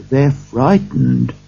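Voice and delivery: plain reading voice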